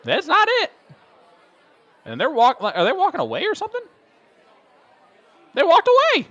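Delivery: very high, incredulous squeal; voice up several pitches. Real, not acted. Commentator seeing players walk away too soon, high-pitched disbelief. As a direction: let the voice leap way up in disbelief. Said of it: "my voice went up eight mark, eight pitches".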